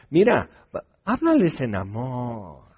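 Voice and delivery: mock the gentle advisers, sugary and coaxing. Someone urging Ezra to love the sinners, in a mock coaxing voice. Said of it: "y dices".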